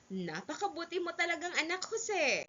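high-pitched fond praise; praising parent. Acted. Parent praising helpful son Jose, fond.

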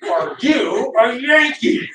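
hammed-up slow, high-pitched demand; mock angry. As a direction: imitate an exasperated, angry stranger. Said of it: "he's mad".

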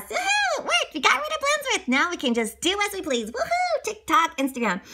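put-on very high gleeful kids; excited cheer. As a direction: whoop with triumph. Kids celebrate.

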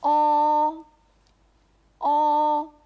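long level "お" with firmly rounded lips; rounded Mandarin o. Shown as a demonstration. Native model repeats the second vowel, rounded steady "お". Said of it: "口を丸めましょう".

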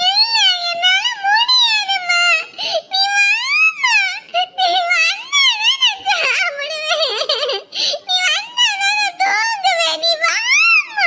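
high, slow tearful pleading; crying child. Acted. Boy crying to mum that he won't eat or sleep without her. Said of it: "சின்ன நொட்ட மாதிரி தான் அழுதுகிட்டு இருப்ப".

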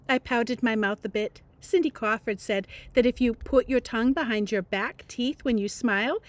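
plain reading voice

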